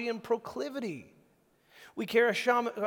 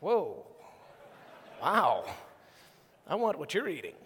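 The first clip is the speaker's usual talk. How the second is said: mock amazement; 4 semitones lower than usual.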